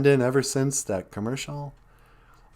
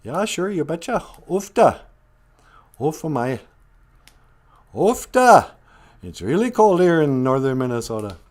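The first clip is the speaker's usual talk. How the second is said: higher, hammed-up Norwegian-Minnesota lilt; mock Norwegian Minnesotan; 5 semitones higher, 3 dB louder than usual. Mocking how Minnesota Norwegians talk, in a hammed-up lilt. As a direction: lay on the Ufta like an old Norwegian. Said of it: "comes from the Norwegians".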